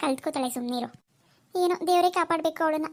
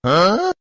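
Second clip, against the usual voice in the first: bright bashful laugh; shy giggle; 8 semitones lower, 11 dB louder than usual. Acted. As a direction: giggle shyly at the proposal. Husband says I love you. (ನಾಚಿಕೆಯ ನಗು)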